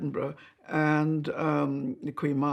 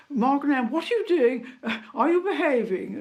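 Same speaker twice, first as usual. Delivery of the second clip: a higher, mock-stern royal telling-off; imitating the Queen scolding; 9 semitones higher than usual. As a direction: playfully imitate the Queen catching two naughty girls. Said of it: "I can remember the Queen saying".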